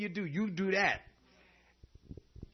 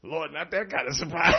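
ordinary talk

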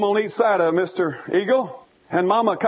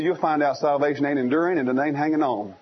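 normal talking voice

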